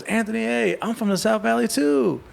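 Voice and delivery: put-on breathy cutesy giggly girl; soft young homegirl voice. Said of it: "a little soft, like, young home, young, like, young homegirl voice".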